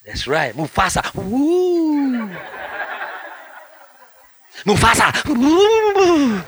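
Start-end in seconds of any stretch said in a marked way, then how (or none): 1.2-6.4 high, loud whooping cheer; excited whoop. Real, not acted. Preacher cheering the name of the king. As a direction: whoop for the hero like a fan. Hyping the Lion King hero.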